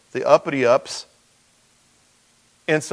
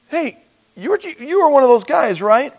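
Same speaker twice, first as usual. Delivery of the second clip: accusing call; 13 semitones higher, 7 dB louder than usual. Acted. Servant accusing Peter by fire.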